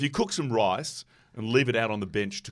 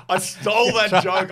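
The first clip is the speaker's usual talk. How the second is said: laughing; 10 semitones higher, 11 dB louder than usual. Real, not acted. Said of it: "I gotta admit".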